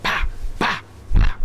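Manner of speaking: voiced slaps, pa pa pa; slap sounds. Friend slapping the dead man's head, imitated slap noises. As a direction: mimic the slaps with your mouth. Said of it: "he starts slapping him in the head".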